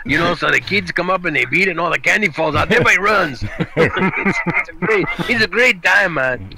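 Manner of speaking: put-on Chicano accent.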